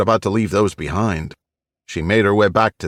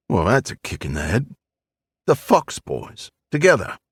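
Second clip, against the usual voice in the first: put-on old East End Cockney voice; gruff old Londoner, Cockney; Cockney accent; 3 semitones higher than usual. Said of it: "with an East End Cockney accent".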